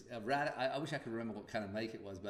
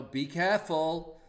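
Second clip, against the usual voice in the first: mock warning; 6 semitones higher, 10 dB louder, slower than usual. Quoting a warning never said.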